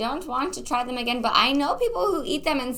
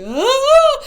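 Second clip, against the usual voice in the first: high, loud imitated cry of alarm; mock panicked "ah"; body in shock; 12 semitones higher, 6 dB louder than usual. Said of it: "your body's like".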